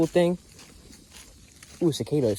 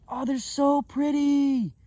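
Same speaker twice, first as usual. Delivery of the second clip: admiring; 9 semitones higher, much slower than usual. Real, not acted. Admiring the find.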